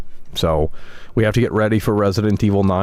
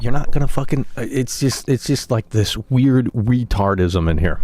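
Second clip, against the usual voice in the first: ordinary delivery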